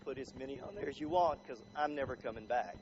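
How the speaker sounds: low, soft, smiling retort; understated cheek, dropped low and soft; smiling defiance. Acted. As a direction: answer back quietly with a smile, utterly unbothered. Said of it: "you turn and you smile and you say".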